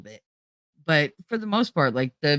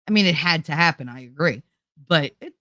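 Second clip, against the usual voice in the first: ordinary talk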